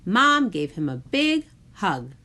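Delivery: ordinary delivery, nothing marked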